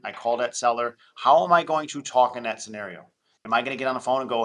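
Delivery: usual speaking voice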